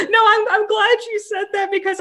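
very high laughing reply. Real, not acted. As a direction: laugh while answering.

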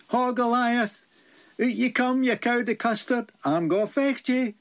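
high, bold challenge called across the field; cheeky high call to come out and fight. Acted. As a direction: hail the giant out to fight, high and cocky. Davy calls out challenging Goliath.

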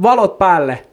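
high mock shout, laughing at the end; retold shout. Insulting the man walking off, retold shout. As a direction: call it after him, pause, then the insult. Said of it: "Mä huusin jo perään silti".

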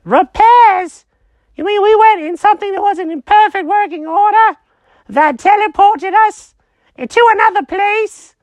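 high panicky squeak; alarmed cat voice. Acted. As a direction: freak out in a tiny cat voice. Jenkins alarmed.

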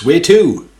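a quick high loud "where to"; taxi driver voice. Retold or imitated. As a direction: snap out the question like a cabbie. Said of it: "Except in his Caribbean accent".

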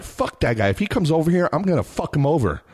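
high-pitched mock tough threat; retold angry threat. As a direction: bluster back at the worried wife. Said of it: "'cause I was so angry".

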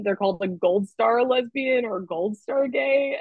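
ordinary talk, nothing marked

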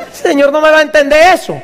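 usual speaking voice

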